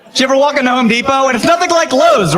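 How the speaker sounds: high and fast, pulling the crowd in; excited question. Real, not acted. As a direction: rush it out eagerly.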